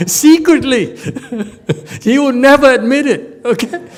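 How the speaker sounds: laughing through the words, high and loud; can barely get it out for laughing; giggling teacher spilling a secret. Real, not acted. Teacher laughing at own joke.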